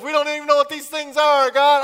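a flustered new man; Adam, high and fast, baffled by animals; bewildered Adam. Retold or imitated. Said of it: "Adam voiced confused".

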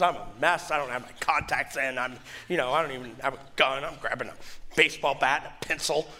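usual speaking voice

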